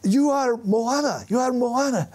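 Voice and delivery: put-on high, eager voice of a teenage fan; excited young fan. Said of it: "he came up to me and he said".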